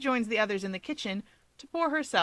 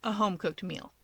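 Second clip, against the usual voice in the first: plain reading voice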